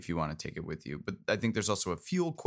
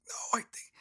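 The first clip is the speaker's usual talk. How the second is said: unvoiced whisper; 6 dB quieter than usual. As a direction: breathe it out, no voice. NPC reply whispered.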